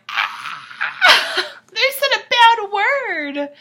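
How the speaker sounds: high, slow, giggly mock of kids tittering. Mocking the young fans giggling at a swear word. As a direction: laugh at a bad word like a ten-year-old. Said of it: "all the youth was like".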